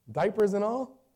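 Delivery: high, loud, rising challenge; incredulous question. Acted. Doubter testing a parent about a baby, incredulous. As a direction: query it with disbelief.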